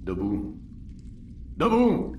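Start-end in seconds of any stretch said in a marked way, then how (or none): 1.6-2.1 sudden high shout; barked wake-up order. Acted. Guard waking sleeping prisoners, loud shout. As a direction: jolt them awake with a yell.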